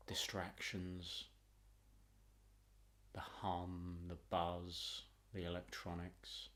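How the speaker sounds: calm, unhurried meditation voice with long pauses; guided meditation. Acted. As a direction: guide the listener gently inward, phrase by phrase.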